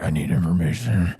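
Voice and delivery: low, lightly voiced Batman demand; a mild mock Batman growl; gruff Batman. Retelling the deleted scene, mock Batman voice. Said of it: "he's like".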